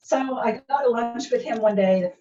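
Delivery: ordinary talk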